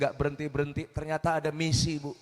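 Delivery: normal talking voice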